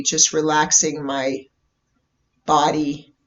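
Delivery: guided meditation. Real, not acted. Host leading listeners into a bubble meditation, calm guided meditation. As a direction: ease them into stillness, unhurried, letting each phrase settle.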